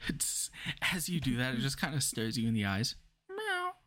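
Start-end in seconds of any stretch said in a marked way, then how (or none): 3.3-3.8 high playful meow; cat meow. Acted. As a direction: squeak out a cute meow. GM voices the cat.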